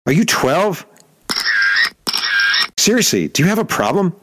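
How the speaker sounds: high, incredulous retort; high-pitched disbelieving rebuke; exasperated challenge. Retold or imitated. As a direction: scold him like a child. Confronting Rocker angrily.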